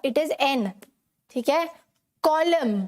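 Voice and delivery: normal talking voice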